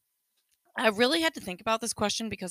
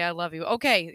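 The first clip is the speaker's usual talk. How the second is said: ordinary talk, nothing marked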